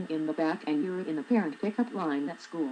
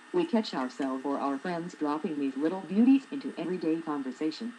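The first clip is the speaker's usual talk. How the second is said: normal talking voice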